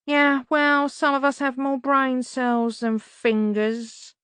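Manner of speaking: high, slow sneering bird voice; muttering raven. A narrator's reading. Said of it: "muttered a voice".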